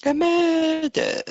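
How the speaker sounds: very high, drawn-out falsetto; teasing falsetto, stretched out; mock-dramatic echo. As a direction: squeal it slowly in mock alarm. Same teasing echo repeated.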